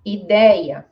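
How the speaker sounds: "Ideia" as ee-DAY-ya, loud on the middle; vowel cluster. Shown as a demonstration. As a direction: say "Ideia" with a clear stressed E. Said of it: "aqui eu tenho três vogais juntas".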